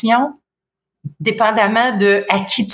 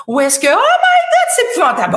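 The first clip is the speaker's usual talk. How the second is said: way higher, swooping, laughing; rollercoaster intonation; 10 semitones higher, faster than usual. Shown as a demonstration. Coach demos a rollercoaster intonation. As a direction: overdo the melody wildly. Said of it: "intonation en montagne russe".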